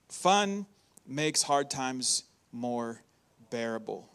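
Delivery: a pastor repeating the point gently. Real, not acted. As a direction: drop the voice and slow right down. Restates point three, slower.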